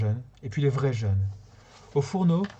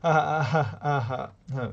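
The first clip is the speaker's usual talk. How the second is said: forced laugh; much slower than usual. Pretend. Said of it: "Je me suis rattrapé comme j'ai pu".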